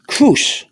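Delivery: "Cruz" said as kroos, with an oo sound; pronunciation demo. Shown as a demonstration. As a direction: model "Cruz" alone with a rounded oo. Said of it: "how you pronounce the".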